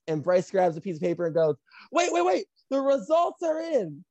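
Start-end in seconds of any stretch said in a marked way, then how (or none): 1.9-4.0 high-pitched call for everyone to stop; mock urgent announcement. Bryce on the mic with test results, high urgent announcement.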